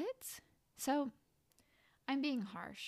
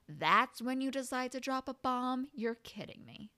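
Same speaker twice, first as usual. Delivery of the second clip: ordinary delivery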